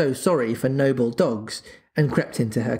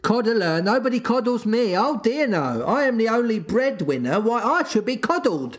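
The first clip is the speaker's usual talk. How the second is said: high-pitched indignant tirade; mock outburst, pitched up without much volume; shouted; 9 semitones higher than usual. A narrator's reading. Father raging that nobody coddles him, shouted. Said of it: "he shouted".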